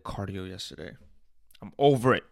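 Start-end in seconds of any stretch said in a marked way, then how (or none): 1.6-2.2 louder, fed-up declaration; loud, fed-up announcement; emphatic. Real, not acted. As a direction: say it like you mean it. Repeating himself.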